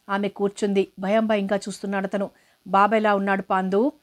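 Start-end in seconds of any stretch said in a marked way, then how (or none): none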